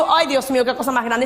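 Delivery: usual speaking voice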